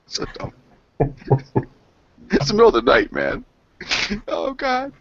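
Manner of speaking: words breaking up with laughter; laughing. Real, not acted. Gamer laughing at his own scare in the middle of the night, laughing. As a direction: talk through a high, helpless laugh.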